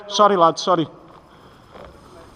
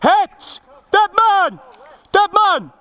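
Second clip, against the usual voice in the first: high yelled call; man down shout; 12 semitones higher, much slower than usual. Real, not acted. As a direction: shout that you're out. Player hit, calling out.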